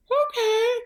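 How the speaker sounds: high, chirpy okay; a bright delighted yes; imitating their high eager agreement. Couple agreeing to go.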